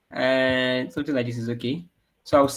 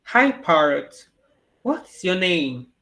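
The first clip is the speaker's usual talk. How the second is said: slow, drawn-out recording voice, slightly raised; lightly voiced talking cat; 3 semitones higher, 4 dB louder, slower than usual. Shown as a demonstration. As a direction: greet the parrot slowly, word by word. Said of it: "I'm gonna record my voice now".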